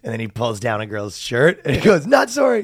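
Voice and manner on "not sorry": high, loud mock gloat; hammed-up gloating yell; gleeful shout. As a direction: shout it out triumphantly. Punchline after fake apology.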